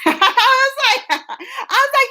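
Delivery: high, slow, giggling through it; can't stop laughing at the memory; real laughter breaking the words. Real, not acted. Laughing while retelling fight.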